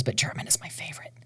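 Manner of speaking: quick soft whisper. Real, not acted. Singer confiding favorite language to interviewer, whispered. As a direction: let it slip out as a whisper.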